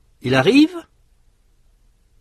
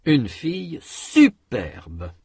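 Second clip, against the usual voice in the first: slow, SU of superbe punched; emphatic stress; 3 semitones higher, slower than usual. Shown as a demonstration. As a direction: insistence on the first syllable of superbe. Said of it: "Répétez".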